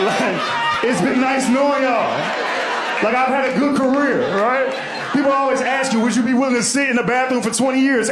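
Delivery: loud, high half-laughing shout; hammed-up shouting about going to jail; mock rant. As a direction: rant it big and loud for the crowd. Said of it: "I started laughing 'cause I was like".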